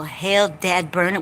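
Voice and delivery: usual speaking voice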